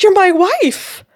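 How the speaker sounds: high, loud, drawn-out disbelief; astonished realization. Retold or imitated. Client telling the medium she's his wife, loudly astonished. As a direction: stretch it out, utterly amazed.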